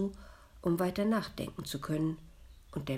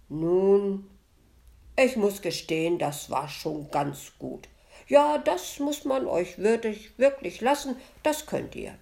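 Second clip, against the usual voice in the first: loud, higher-pitched patronising approval; benevolent; 5 semitones higher, 9 dB louder than usual. A narrator's reading. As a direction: grant it generously, pitch lifted. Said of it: "Der Metzger meinte wohlwollend". (wohlwollend anerkennend)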